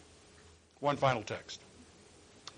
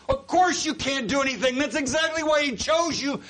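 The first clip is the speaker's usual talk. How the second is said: high, loud, voice breaking with feeling; full-force preaching; 11 semitones higher, 4 dB louder than usual. Real, not acted. Preacher urging the congregation, high and loud with breaking voice. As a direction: preach it hard with a catch in the throat.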